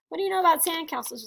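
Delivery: plain reading voice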